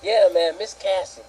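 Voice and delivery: normal talking voice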